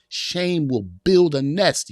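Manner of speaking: slow, loud, every word of "Shame will build a nest" pressed hard; emphatic repeat. Acted. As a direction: hammer home "Shame will build a nest" slowly and loudly. Preacher repeats key line emphatically.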